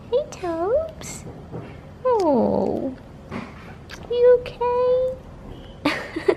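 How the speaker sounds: high, very slow coo at a pet; high drawn-out pet talk. Real, not acted. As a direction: coo softly at a sleepy puppy. Cooing to her sleepy dog.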